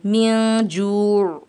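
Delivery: म्यों जू recited very slowly and drawn out; slow Quranic recitation. Shown as a demonstration. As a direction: chant म्यों जू slowly, as in tilawat. Recites the phrase as a demo. (धीमी तिलावत के अंदाज़ में म्यों जू)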